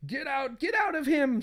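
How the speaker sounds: high acted exorcism plea; desperate wife. Wife pounds on possessed husband, high and desperate.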